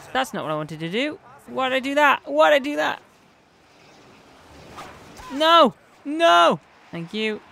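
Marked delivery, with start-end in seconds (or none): 1.5-3.0 loud, higher-pitched self-reproach; real gamer frustration; exasperated outburst. Real, not acted. Frustrated at own game mistake. 5.3-6.6 loud, high cry of protest; sharp, loud gamer outburst; dismayed shout. Real, not acted. Shouts at game going wrong.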